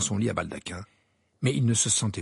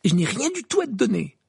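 high, louder, irritated dismissal; curt snap; 10 semitones higher, 5 dB louder than usual. A narrator's reading. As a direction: snap at the waiting owl. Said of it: "ajouta Harry d'un ton sec". (d'un ton sec)

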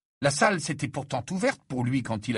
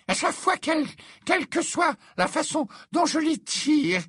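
put-on very high, haughty old seer; a slighted prophetess warning darkly; offended seer; 9 semitones higher than usual.

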